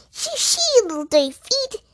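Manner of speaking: high, fussy little scold; tidy mouse scolding a beetle, high-pitched. A narrator's reading. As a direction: shoo the beetle off, prim and high. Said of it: "said Missus Tittlemouse, clattering her dustpan".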